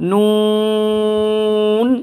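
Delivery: নুন held long from the tongue tip; drawn-out Nun. Shown as a demonstration. Teacher demonstrating a long Nun. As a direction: stretch নুন for three alif lengths. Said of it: "তিন আলিফ টানবেন".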